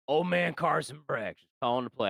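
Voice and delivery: put-on old-timer's True Grit western accent. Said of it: "I don't know why I gave him that accent".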